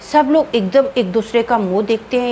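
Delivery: plain reading voice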